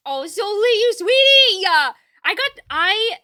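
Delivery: high, loud, drawn-out gushing; gushing thanks. Real, not acted. Streamer gushes high and loud at a generous viewer. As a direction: gush with gratitude, slowly.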